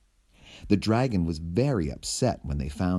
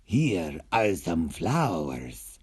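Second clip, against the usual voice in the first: slow, lightly voiced kindly villain; villain offering; 4 semitones lower, slower than usual. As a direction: gently offer flowers. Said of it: "said the villain".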